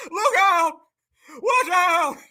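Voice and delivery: high, hammed-up warning call; mimicking a panicked holler; mock warning cry. Mimics the played man's warning yell.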